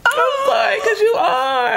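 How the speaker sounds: tearful, high-pitched affirmation; emotional. Real, not acted. Affirming a friend's worth, emotional and tearful. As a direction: insist on the compliment while crying. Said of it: "I just got emotional".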